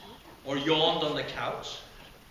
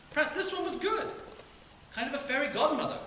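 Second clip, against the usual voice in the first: hopeful lift; 12 semitones higher, faster than usual. Acted. Hopeful musing on good fairy.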